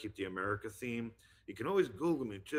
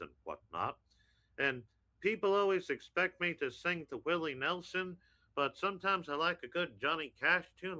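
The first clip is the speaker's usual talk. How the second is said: higher-pitched cartoon Texan dad; folksy, emphatic Texan preamble; Hank Hill; 7 semitones higher, 5 dB louder than usual. Introducing a quick song for the host, Hank Hill impression. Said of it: "give you a quick song as Hank".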